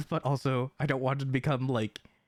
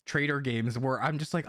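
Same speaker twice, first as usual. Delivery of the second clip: ordinary talk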